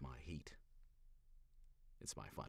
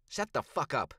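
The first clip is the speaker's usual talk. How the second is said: louder, higher snarl; growled; 8 semitones higher, 8 dB louder than usual. Acted. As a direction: growl it to shut him up. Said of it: "Stella growls".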